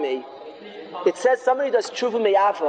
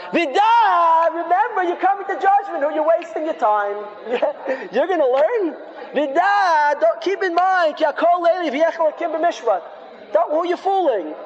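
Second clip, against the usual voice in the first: high, raised accusing voice of sin; screaming accuser; 6 semitones higher than usual. Acted. Bad Aveira confronts the man who wants to learn, screaming accusations. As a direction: hurl it at a sinner like a taunting prosecutor. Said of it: "the bad Aveira screamed very loud".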